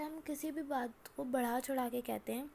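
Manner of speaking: usual speaking voice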